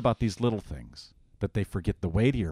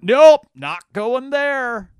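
much higher and louder, slow and stubborn; old Adam refusing; 13 dB louder, much slower than usual. Retold or imitated. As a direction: shout a flat refusal like a sulky sinner. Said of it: "And the old Adam".

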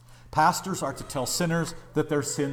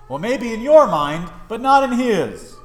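ordinary talk

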